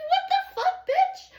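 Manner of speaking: high, loud squeal of disbelief; shocked outburst. Real, not acted. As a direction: shriek it in sudden shock. Startled by what she read.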